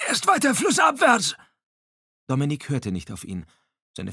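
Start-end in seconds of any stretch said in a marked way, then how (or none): 0.1-1.4 very high, loud imploring cry; urgent pleading. A narrator's reading. Glass implores Dominique not to head for shore, loud urgent plea. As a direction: beg him hard over the rapids. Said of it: "beschwor Glass ihn".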